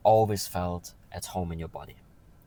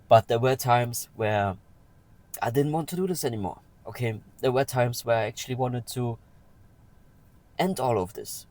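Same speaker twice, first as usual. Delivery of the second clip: getting emotional; 4 semitones higher, 5 dB louder than usual. Real, not acted. Vlogger opening up to viewers about past suicidal times, emotional. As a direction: voice rising high and louder as emotion breaks through. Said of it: "I'm getting a bit emotional".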